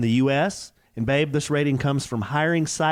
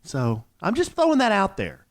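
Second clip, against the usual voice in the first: high, teasing hint at big news; excited teaser; 8 semitones higher than usual. Acted. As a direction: dangle the secret playfully.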